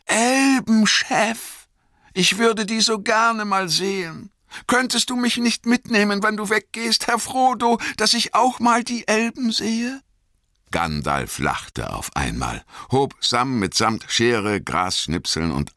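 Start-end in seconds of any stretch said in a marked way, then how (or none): 0.1-10.0 high, loud and wistful plea; a servant dreaming of elves; high-pitched, eager longing; Sam, longing. Acted. Sam voiced by narrator.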